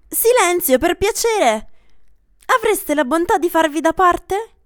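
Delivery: loud firm order; a doctor ordering the crowd aside; commanding. Acted. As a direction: clear the room with authority. Someone orders the crowd back.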